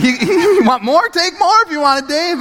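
very high, overjoyed squeal; excited. Retold or imitated. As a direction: offer it eagerly in a hammed-up high voice. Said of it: "never been more excited".

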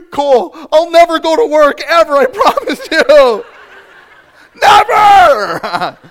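very high, loud blubbering vow; mock hysterical sobbing shout; hammed-up wailing. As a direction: wail it out like an overwrought moviegoer. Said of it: "At this time, I'm like".

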